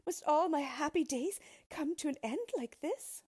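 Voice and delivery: desperate princess voice; despairing cry. A narrator's reading. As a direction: lament your fate loudly. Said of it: "she cried".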